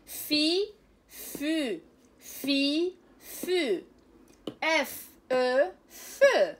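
loud, drawn-out teacher drill of each syllable. Shown as a demonstration. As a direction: belt out each sound for the class to copy.

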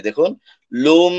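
লুম held with a long nasal hum; nasal merge demo. Shown as a demonstration. Teacher shows students the merged ghunna. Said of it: "আমরা মিলাচ্ছি এবং গুণনা করছি".

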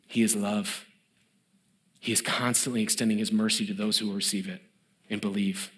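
soft, low, unhurried sermon voice; whispering-close preacher, letting each phrase land; hushed reflective preaching. Acted. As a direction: draw them in quietly to God's mercy.

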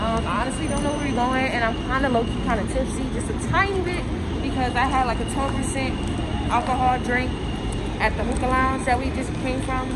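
muffled behind cloth mask; speaking with covered mouth; through a face mask. Real, not acted.

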